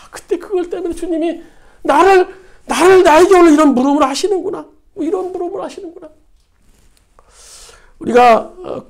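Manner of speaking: ordinary talk, nothing marked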